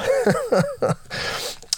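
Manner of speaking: high squeaky burst of laughter. Real, not acted. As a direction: let out a high giggle. Delighted laugh about the bear.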